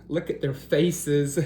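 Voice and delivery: usual speaking voice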